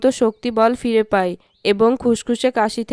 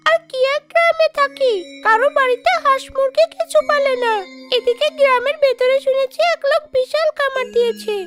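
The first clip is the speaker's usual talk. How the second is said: high-pitched witchy ghost voice; high ghost-woman grumbling, then pleased; scheming shakchunni ghost; 8 semitones higher than usual. Acted. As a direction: plot aloud as a greedy high-voiced ghost. Scheming ghost talks to herself.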